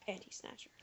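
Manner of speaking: a cheeky sneaked-in whisper; whispered aside. Acted. Slipping in a rude rhyme during the chat, whispered. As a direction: whisper it under your breath.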